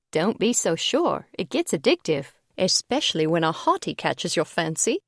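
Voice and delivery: teasing British lilt; a knowing London girl's tease; light British accent. Acted. British advisor teases about hotties, light British accent. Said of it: "Her accent was delicious".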